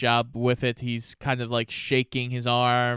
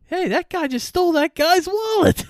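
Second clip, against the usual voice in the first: much higher, louder mock alarm; high mock outraged voice; 5 dB louder than usual. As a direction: squeal it like a scandalised bystander. Jokey quoted reaction.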